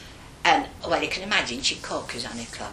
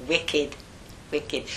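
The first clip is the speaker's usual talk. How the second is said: usual speaking voice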